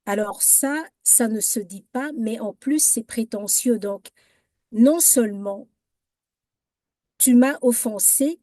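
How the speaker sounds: normal talking voice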